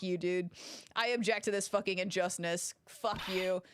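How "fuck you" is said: ordinary talk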